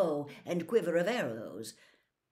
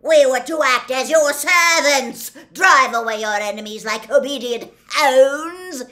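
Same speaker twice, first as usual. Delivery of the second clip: very loud, raised, indignant centaur tirade; furious bellow; 7 semitones higher, 15 dB louder than usual. A narrator's reading. Gray centaur rails at human arrogance, bellowing furiously. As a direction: thunder it out in outrage. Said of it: "and bellowed".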